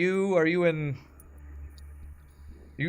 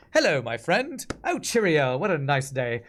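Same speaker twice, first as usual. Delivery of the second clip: exaggerated, louder mock British; bad British accent; 3 dB louder than usual. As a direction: put on a hammy fake British accent. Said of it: "us trying to do a British accent".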